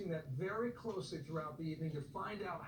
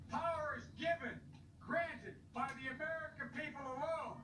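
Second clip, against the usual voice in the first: rally speech; 7 semitones higher than usual. Acted. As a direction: rouse the crowd to go vote. Impassioned rally speech to voters.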